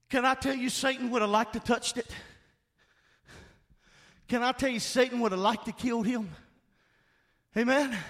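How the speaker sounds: ordinary talk, nothing marked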